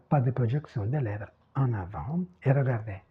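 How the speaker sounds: ordinary talk, nothing marked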